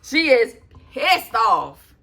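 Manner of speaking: PISSED punched loud and stretched; slow, loud relish on "pissed off"; drawn-out emphasis. Real, not acted. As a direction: hammer "pissed off" slowly. Gleeful emphatic verdict.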